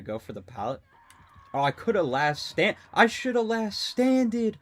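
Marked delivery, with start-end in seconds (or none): 1.5-4.6 high, loud, stretched-out wail of regret; frustrated groan. Real, not acted. Streamer wailing at his own missed play. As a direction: moan it like you just blew the round.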